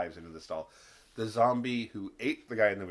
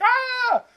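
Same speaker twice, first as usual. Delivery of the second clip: sudden high shrieking yelp; mock terrified scream; 13 dB louder than usual. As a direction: shriek in fake terror, very high and loud. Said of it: "And he's like".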